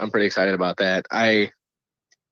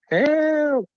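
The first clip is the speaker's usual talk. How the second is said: very high, drawn-out mock cry for help; imitated scream; 3 dB louder than usual. Retelling voices heard on a haunted island, imitated high scream. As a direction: imitate a distant voice screaming, pitched way up. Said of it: "we started to hear voices screaming".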